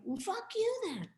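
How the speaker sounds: sneering high-pitched retort; mock-voiced rude kid brushing her off; rude young guy. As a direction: toss it off like a snotty kid.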